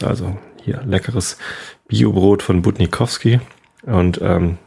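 normal talking voice

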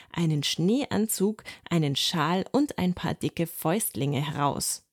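ordinary delivery

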